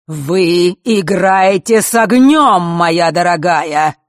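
loud, slow, hard warning; harsh retort. A narrator's reading. Old Fatum answers the villainess's threat, harshly. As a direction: stretch out the threat forcefully. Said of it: "жёстко отозвался тот".

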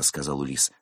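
usual speaking voice

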